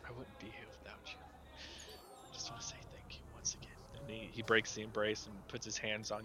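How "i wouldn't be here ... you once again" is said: hushed, hurried whisper in the ear; very soft, quick confiding thanks. Acted. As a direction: whisper it privately, heartfelt. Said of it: "he whispers in your ear".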